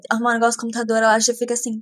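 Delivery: usual speaking voice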